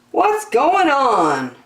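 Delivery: high, slow puzzled monkey voice; a grumpy monkey who can't work out his day; confused monkey. Acted. Jim confused, story voice.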